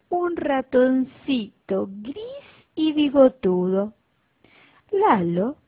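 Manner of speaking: usual speaking voice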